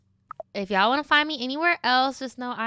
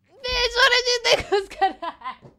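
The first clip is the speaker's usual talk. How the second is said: high, loud mocking shriek; loud, high squeal of disbelief; 5 dB louder than usual. Real, not acted. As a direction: shriek it at the fool. Shrieking at fired worker.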